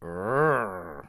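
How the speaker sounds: deep growl; low mechanic growl. Shown as a demonstration. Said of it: "you are in a lot of trouble".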